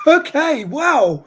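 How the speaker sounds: sudden high, loud okay; surprised. Real, not acted. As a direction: caught off guard by the heat. First spray of hot sauce.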